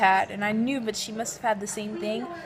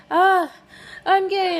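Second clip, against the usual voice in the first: higher and louder, a happy burst; excited exclamation; 9 semitones higher, 7 dB louder, slower than usual. Retold or imitated. Teen announcing to herself at new school, excited and much higher and louder. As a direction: shout it out with joy. Joyful self-acceptance moment.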